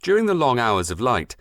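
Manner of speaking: ordinary delivery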